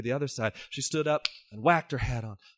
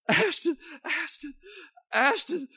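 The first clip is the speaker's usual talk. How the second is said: high, broken calls of her name; a husband calling his buried wife; anguished calling. Acted. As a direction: call her name in grief.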